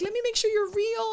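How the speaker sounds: high, loud squealing imitation; overwhelmed fan. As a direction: mimic a tearful fan in disbelief. Overwhelmed crying fan, retold.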